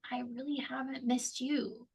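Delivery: quiet inner thought. Retold or imitated. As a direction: say it under your breath, as if only to yourself. Inner thought, quoted in mind.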